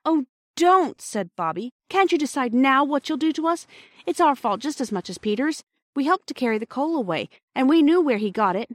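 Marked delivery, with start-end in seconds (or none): 0.1-1.0 high, loud plea; pleading. A narrator's reading. Bobby begs the station master. 1.9-8.7 high, urgent confession to share blame; pleading girl. A narrator's reading. Bobby pleads with station master.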